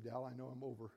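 ordinary talk, nothing marked

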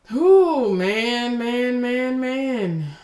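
high, loud, drawn-out wail; overcome, crying out through tears; real tearful outcry. Real, not acted. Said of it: "crying on here".

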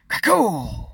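high, loud exploding vocal crash; imitated lightning crack. Narrator voicing lightning splitting sky, loud crack. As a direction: crack it out, pitch falling.

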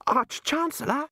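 high, drawn-out gasping cry; gasp, then call out in high alarm. Acted. Ponder calling the Arch-Chancellor on seeing something wrong, alarmed.

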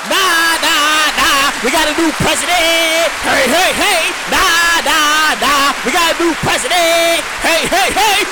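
loud hyped-up intro chant; hype shout. Real, not acted. Host hyping up his podcast, loud chant. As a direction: shout it out to open the show.

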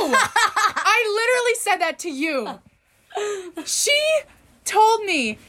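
high indignant protest, laughing; loud mock outrage; shrill overlapping reality fight. Real, not acted.

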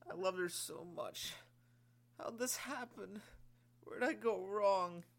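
wailing sob. Acted. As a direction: wail it, broken-hearted. Sergeant keeps sobbing.

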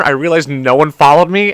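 usual speaking voice

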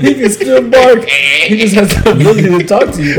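high, loud words through laughter; laughing protest. Real, not acted. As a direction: argue while cracking up. Laughing argument about talking dogs.

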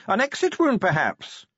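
very high, cheerful little suggestion; cheerful Grag. A narrator's reading. Grag offers Vimes an explanation of the mark, cheerfully. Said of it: "said the Grag cheerfully".

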